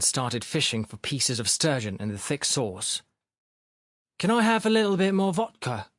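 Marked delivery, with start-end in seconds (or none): 4.2-5.9 high-pitched asking of "a little bit more vodka"; interrogative. A narrator's reading. Sharikov asking for "more vodka", interrogative. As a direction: pitch "Can I have" high, as a request. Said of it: "he declared interrogatively".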